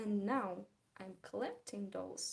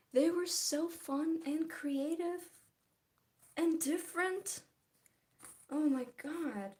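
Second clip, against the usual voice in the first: excited; 5 semitones higher, 4 dB louder than usual. Real, not acted. Gushing about dolls.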